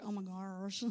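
low, soft drawled mock accent; imitated local accent. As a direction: parody the local kids' accent, drawn out. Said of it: "over here, we're all like".

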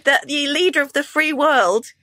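ordinary talk, nothing marked

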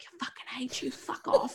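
angry whisper, retold; soft, venomous mock whisper; hushed swearing. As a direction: swear under your breath so the baby isn't disturbed. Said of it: "I'm trying to hushly swear".

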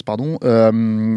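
usual speaking voice

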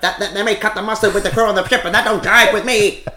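loud, high forties tough-guy voice; brash old-time boxer from the movies. Retold or imitated. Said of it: "trying to sound like a boxer from a movie from the forties".

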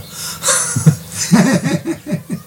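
a sudden high giggle; high breathy laugh. Real, not acted. Laughing at her own mistake in French, a short high giggle. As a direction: laugh off the slip.

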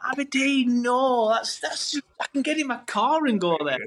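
high-pitched mock-fuming protest. Football fan fuming at the Aberdeen draw, high-pitched indignation. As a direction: protest in exasperation, pitched well up. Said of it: "we were all fuming".

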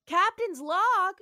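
loud, slow, high opening; captain's log announcement. Acted. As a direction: announce the log grandly, drawn out. Opens an acted captain's log.

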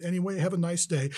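sugary-polite doorstep farewell, lightly imitated; sweetest tone. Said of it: "in the sweetest tone of voice".

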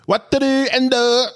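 pitched high, hammed-up Japanese accent; mock Japanese accent. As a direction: welcome him like a playful fake Japanese announcer. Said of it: "can speak a bit of Japanese".

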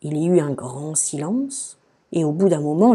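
plain reading voice

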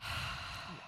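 breathy exasperated sigh, imitated; heavy sigh. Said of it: "Yeah, you do the".

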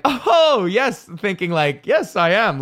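high, bright eager answer. Retold or imitated. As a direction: jump in like an excited kid. Eager young comic answering.

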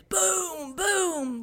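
high, drawn-out boom sound; imitated booms. Storyteller imitates booms chasing the family, high drawn-out booms. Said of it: "loud booms and screaming".

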